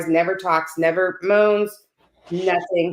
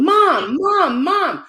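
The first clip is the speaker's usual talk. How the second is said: high, loud call for mom; imitated yelling; 10 semitones higher, 6 dB louder, much slower than usual. Retelling her sick mother calling from bed, yelling. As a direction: yell out like a mother calling urgently. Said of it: "my mother starts yelling".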